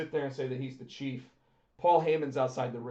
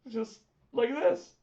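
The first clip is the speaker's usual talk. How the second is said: normal talking voice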